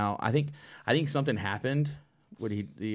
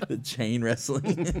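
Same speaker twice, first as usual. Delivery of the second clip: laughing; 10 semitones higher, slower than usual. Real, not acted. Laughing through the joke.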